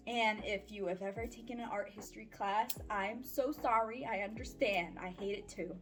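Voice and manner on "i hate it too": usual speaking voice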